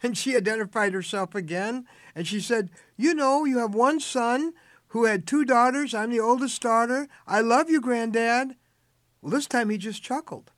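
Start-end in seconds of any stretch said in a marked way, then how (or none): 3.0-8.6 put-on loud and high, so an old man can hear; speaking loudly. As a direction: call it out brightly to a hard-of-hearing grandfather. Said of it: "she spoke loudly so he could hear".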